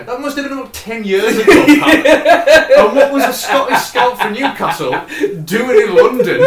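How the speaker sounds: high, loud, laughing incredulity; real incredulous laughter pushing the voice up; laughing disbelief. Real, not acted. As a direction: crack up while questioning the absurd plot. Incredulous laughing dig at the film's plot.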